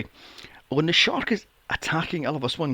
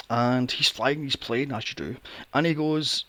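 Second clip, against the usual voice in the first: ordinary delivery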